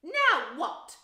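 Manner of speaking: high, very loud demand; furious. A narrator's reading. Aunt blaming her nephew, furious. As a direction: snap it out in exasperated fury. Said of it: "looking furiously at Harry".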